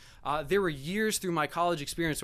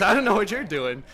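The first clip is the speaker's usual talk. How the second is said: mock retort; 5 semitones higher, 8 dB louder than usual. Guest retorts to the dad.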